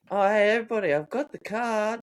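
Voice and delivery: high, bright sing-song reveal of the lost card; lightly voiced cheery mate; mock cheerful announcement. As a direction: play the oblivious mate, chirpy and high.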